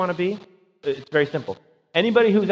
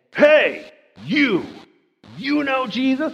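mock call across an alley, higher and louder; pushy street evangelist; 8 semitones higher, 4 dB louder, much slower than usual. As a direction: hail a stranger like an overeager preacher. Preacher mocks pushy street evangelist.

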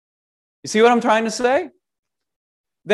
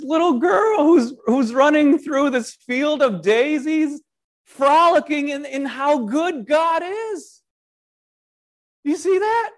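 put-on girlish frolic; 7 semitones higher than usual. Picturing Leviathan as little girl.

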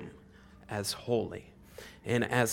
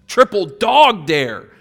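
slow, loud, higher punch on triple dog dare; stress on triple dog dare; 7 semitones higher, 11 dB louder, slower than usual. Shown as a demonstration. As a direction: hit every word of triple dog dare.

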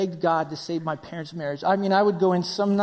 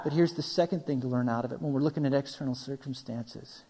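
usual speaking voice

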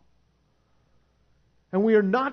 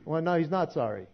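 ordinary delivery

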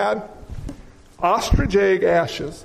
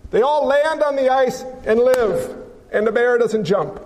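ordinary delivery

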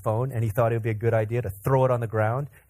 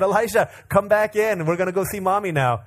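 raised, higher parental call; calling a child back; 5 semitones higher than usual. Retold or imitated. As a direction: coax a wandering toddler back in. Calls toddler back from the porch.

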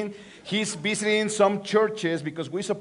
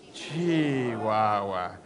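resigned sigh; 11 semitones lower, 6 dB quieter, much slower than usual. Acted. Comic sigh that pastor is absent.

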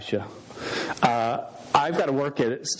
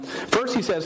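ordinary talk

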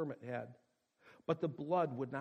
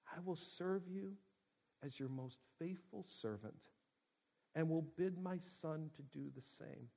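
weak voice; 3 semitones higher, 7 dB quieter than usual. A narrator's reading. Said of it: "in a weak voice".

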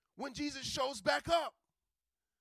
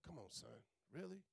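soft, tender pleading to her son; a hushed mother longing for her son; mother's longing; 8 semitones lower, 12 dB quieter than usual. Retold or imitated. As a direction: quietly beg him to stay, full of love. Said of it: "His mama like".

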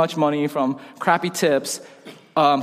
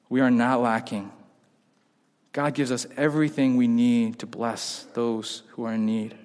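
slow, softer, lowered preaching voice; solemn preaching; 5 semitones lower, 4 dB quieter, slower than usual. Real, not acted. Preacher reminding congregation of its blessings, solemn. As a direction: let each phrase settle, slowly and quietly.

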